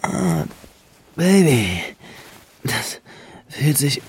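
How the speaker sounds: moaning with pleasure. Acted. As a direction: moan through the words. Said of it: "stöhnte Benjamin".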